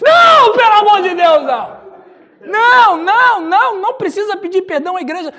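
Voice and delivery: begging him not to, loudly; alarmed no. Retold or imitated. Pastor panics at the request. (não desesperado, bem alto)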